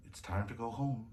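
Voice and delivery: very soft, gentle murmur; hushed bedtime voice; whispered. A narrator's reading. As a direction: tell her tenderly in a hush. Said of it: "whispered the Nutcracker Prince".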